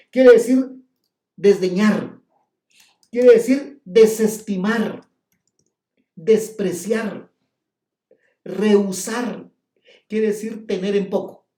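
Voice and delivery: loud, slow preaching of each meaning; preacher hammering out the meanings to the congregation; preaching at full force. Acted. Preacher drives home meanings, loud and slow.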